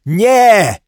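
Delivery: high, loud drawn-out cry; full-voiced shout of protest. A narrator's reading. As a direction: yell it to stop someone. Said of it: "krzyknął łysy".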